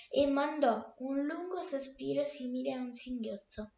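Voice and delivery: plain reading voice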